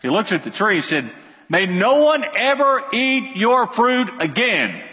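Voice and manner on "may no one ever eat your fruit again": high, slow, emphatic command; emphatic curse. Acted. Jesus curses the fig tree, high and emphatic. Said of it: "Exclamation point, emphatic, imperative".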